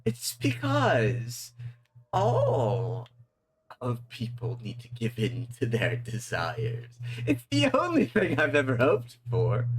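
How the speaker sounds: laughing, rising, grandiose villain; gleeful demon. Acted. Demon explaining his dream to the sheriff, gleeful and grand. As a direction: relish the dream like a fanatic.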